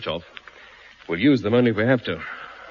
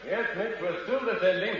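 high, soft reply from far off; faraway, raised-pitch voice; distant call back; 8 semitones higher, 4 dB quieter than usual. Acted. Answering from down the stairs.

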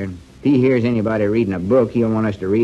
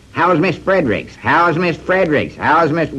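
mocking teasing; 7 semitones higher, 3 dB louder, slower than usual. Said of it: "grin on their faces and say".

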